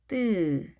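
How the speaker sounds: 뜨 with a tense, unaspirated t; tense consonant. Shown as a demonstration. Teacher models the hard sound after S for learners, tense 뜨. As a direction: say 뜨 with no puff of air. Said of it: "센 소리가 오게 되면".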